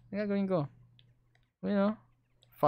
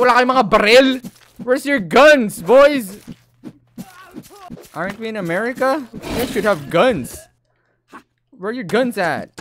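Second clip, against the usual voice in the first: mocking taunt; 8 semitones higher, 11 dB louder than usual. Taunting unarmed villagers.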